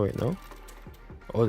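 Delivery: ordinary talk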